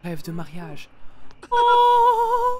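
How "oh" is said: long, high, loud "oh"; squealed oh of amazement; surprised. Real, not acted. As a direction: hold a high, delighted oh. Delighted gasp at scene.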